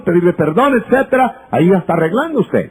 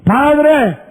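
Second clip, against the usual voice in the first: entitled son; 6 semitones higher than usual. Acted. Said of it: "presto la parábola otra vez".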